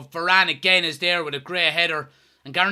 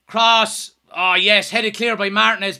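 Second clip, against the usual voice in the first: normal talking voice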